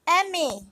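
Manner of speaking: M A run together as "EH-meh"; Spanish letter M. Shown as a demonstration. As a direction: say M A as one Spanish word, eme. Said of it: "M is pronounced as".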